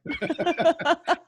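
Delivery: high-pitched real laugh; amused laugh. Real, not acted. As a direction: laugh at your own joke. Laughs at own bodybuilder joke.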